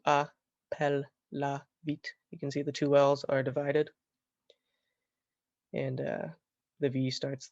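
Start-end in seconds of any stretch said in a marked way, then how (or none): 0.1-2.1 "a, pel, la, vit" in separate beats; split into syllables. Shown as a demonstration. Teacher showing how a Latin word splits, syllable by syllable. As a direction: pause between "a", "pel", "la" and "vit". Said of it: "That gets split up into".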